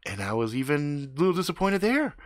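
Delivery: ordinary delivery, nothing marked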